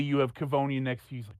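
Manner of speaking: ordinary talk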